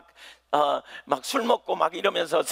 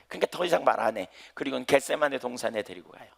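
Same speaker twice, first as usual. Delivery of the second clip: ordinary talk, nothing marked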